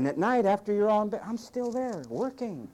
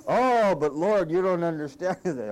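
drawn-out, louder mock whine to God; hammed-up pitiful whine; whiny complaining; 4 semitones higher, 6 dB louder than usual. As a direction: moan it slowly like a spoiled complainer. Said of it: "moaning and groaning".

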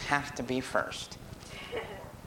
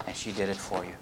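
normal talking voice

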